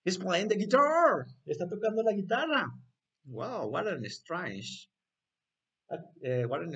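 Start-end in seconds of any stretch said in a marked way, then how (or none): 0.1-2.7 high, loud playful astonishment; hammed-up surprise; amazed reveal. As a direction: announce a wonder to small children. Amazed reveal of guitar-playing banana.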